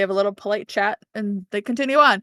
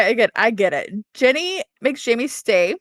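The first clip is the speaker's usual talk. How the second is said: normal talking voice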